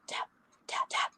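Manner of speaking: soft unvoiced whispered taps; breathy tapping sound. Shown as a demonstration. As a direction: breathe the taps out like a slowed-down beat. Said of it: "like it's kind of this breathy sound".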